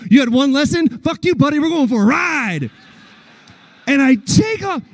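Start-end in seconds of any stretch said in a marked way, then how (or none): 0.1-2.7 high, cocky horse voice; voicing the horse. Horse Old Mexico taunting his rider, cocky. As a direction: give the horse a rude, eager voice. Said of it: "he was like".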